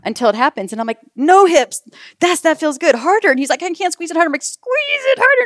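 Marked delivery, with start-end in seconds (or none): 1.1-3.3 high, loud reenacted orders; bossing him mid-contraction, hammed up; shouted commands. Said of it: "shouting out commands to him". 3.6-4.3 high-pitched helpless husband, imitated; mock protest. Retold husband protesting under strain. 4.4-5.4 very high, drawn-out mock screech; shouted command. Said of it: "shouting out commands to him".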